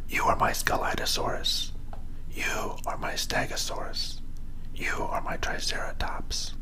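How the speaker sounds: hushed, fully whispered naming. A narrator's reading. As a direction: whisper it softly, like a secret to each toy. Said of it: "He whispered to each one".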